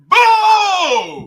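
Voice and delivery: long, loud, high mock boo; berserk crowd booing. Comic imitating the audience booing him, shouted high. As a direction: boo like a whole angry room. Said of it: "It went berserk".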